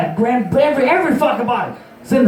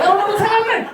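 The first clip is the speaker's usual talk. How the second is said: very high hammed-up freakout; mock panic; 11 semitones higher, faster than usual. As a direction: squeal it in comic alarm. Acts tripping panic.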